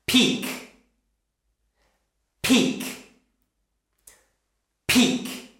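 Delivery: Peak breathed with a hard P burst; all air on the P of Peak; Peak, nearly unvoiced P puff; strong P. Shown as a demonstration. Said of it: "Make it very strong".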